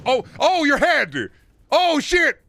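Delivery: high, loud startled yelps; shocked yelp. Real, not acted. Sudden shocked exclamation.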